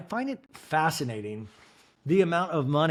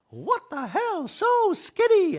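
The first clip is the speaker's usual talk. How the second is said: very high shocked squeal; 4 dB louder, much slower than usual. Retold or imitated. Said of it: "And Dorit's like".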